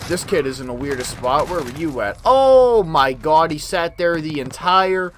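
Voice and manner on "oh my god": loud startled cry; loud shock. Real, not acted. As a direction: exclaim at the camper's hiding spot. Shocked at camper.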